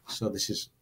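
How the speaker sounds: ordinary delivery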